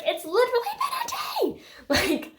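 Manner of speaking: very high incredulous squeal; hammed-up exasperated outburst; mock exasperation. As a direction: squeal it in disbelief. Mock outburst at Bella's clinginess.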